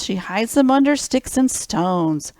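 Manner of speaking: usual speaking voice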